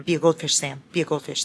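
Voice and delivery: ordinary delivery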